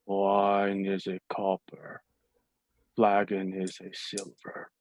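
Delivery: slow, low, soft barkeep drone; bleary bartender. Acted. Bleary barkeep answering a frantic stranger, slow low drone. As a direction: drone it out half-awake, like he's barely there.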